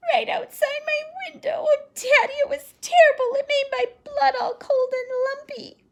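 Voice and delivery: put-on high, loud child's voice close to tears; terrified little girl. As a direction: shudder as you tell Daddy about it. Phoebe terrified, near tears.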